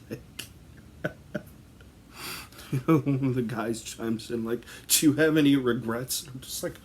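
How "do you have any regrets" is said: mock solemn question. Guy chimes in asking spirit.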